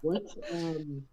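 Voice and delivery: muffled voice; into a pillow. Real, not acted. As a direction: sound muffled as if through a pillow. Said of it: "You sound like you're talking through a pillow".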